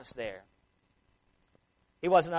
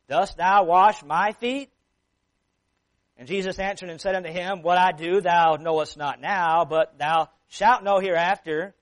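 measured, dramatic Bible reading with louder quotes; scripture reading; 5 dB louder than usual. Acted. Preacher reading John thirteen to his congregation, dramatic reading. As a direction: read the King James passage to the church with weight.

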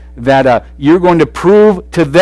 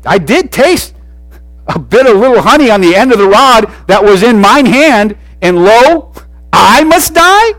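rising, higher and louder, outraged; indignant disbelief; 6 semitones higher, 4 dB louder than usual. Shown as a demonstration. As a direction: say it like you can't believe the sentence. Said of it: "Or he could have said it this way".

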